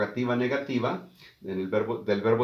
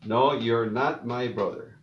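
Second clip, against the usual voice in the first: slow model answer. Shown as a demonstration. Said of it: "aquí está la contracción".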